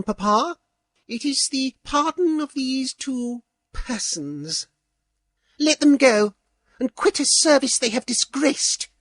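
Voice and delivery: high, cool, scornful plea; haughty princess. A narrator's reading. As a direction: ask a favour with disdain. Princess asks pardon scornfully.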